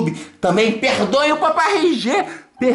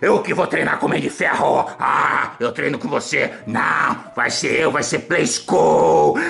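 Hulk voice; 7 semitones lower than usual. Acted. Hulk toy voiced in play.

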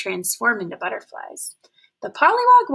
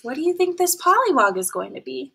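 ordinary delivery, nothing marked

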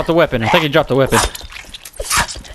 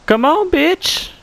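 high, slow, louder jeer; taunting yell; 11 semitones higher, 5 dB louder than usual. Acted. Gamer goading a cannibal he spotted, high slow jeer. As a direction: drag the words out, goading the enemy.

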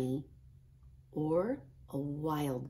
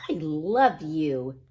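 slow, louder, sweet little-brother reply; little brother Max; 7 dB louder, slower than usual. A narrator's reading. Max answering angry Ruby, slow and sweet. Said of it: "said Max".

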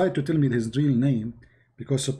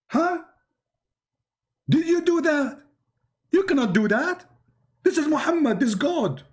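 mock outraged zealot; 12 semitones higher than usual. Zealots scolding anyone who stops the prayer, high mock outrage. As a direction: squeal it like a scandalised believer.

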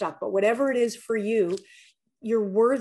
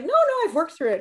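quick, high, brushing it off; defensive denial; 8 semitones higher, 4 dB louder, faster than usual. Retold or imitated. Telling her girlfriend she's over it, hurried and defensive. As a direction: insist you're over it, fast and high. Retold talk with girlfriend, denying.

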